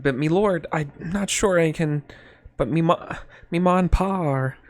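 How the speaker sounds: put-on nervous farm girl, halting and hesitant; stammering peasant girl. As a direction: stumble over the words like a timid serf. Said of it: "she's stammering at this offer".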